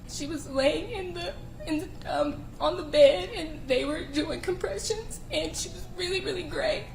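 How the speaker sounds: high, crying voice with halting restarts; tearful recollection. Real, not acted. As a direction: struggle through it in tears. Witness describes friend receiving compressions.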